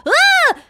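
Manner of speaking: high, loud mock scream; short high yelp of pretend fright. Player sees the villain, screaming in mock fright.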